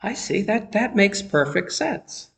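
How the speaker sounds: light, high-pitched "now I get it"; dawning realization. Acted. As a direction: act out suddenly understanding, lifted and hushed. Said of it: "give you these moments of".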